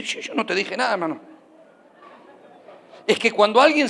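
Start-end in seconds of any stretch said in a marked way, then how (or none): 0.0-1.4 soft, low-key reply; a much softer voiced Jesus; hushed Jesus. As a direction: say it quietly, almost an aside. Jesus gently answers Zacchaeus.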